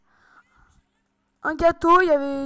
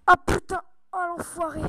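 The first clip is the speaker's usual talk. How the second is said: ordinary delivery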